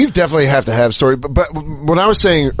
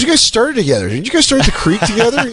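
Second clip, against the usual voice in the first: imitated woman, excited; 8 semitones higher than usual. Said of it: "She goes".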